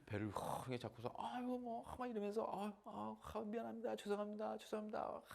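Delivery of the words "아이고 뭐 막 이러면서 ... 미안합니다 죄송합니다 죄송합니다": high, soft apologetic voice; lightly voiced pregnant mother apologizing; flustered apology.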